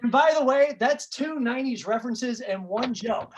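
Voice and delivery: ordinary talk, nothing marked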